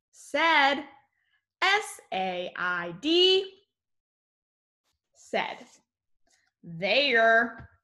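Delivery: teacher leading a sight word spelling chant; sight word drill. Acted.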